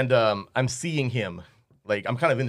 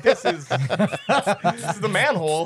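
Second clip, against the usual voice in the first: laughing; 6 semitones higher, slower than usual. Retold or imitated. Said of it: "You're like".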